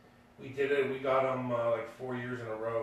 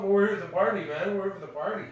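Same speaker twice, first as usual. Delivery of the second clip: higher, louder, mock-innocent party guest; cheerful partygoer; 7 semitones higher, 5 dB louder, faster than usual. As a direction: shrug him off, you came for the party. Partygoers answering Ronnie.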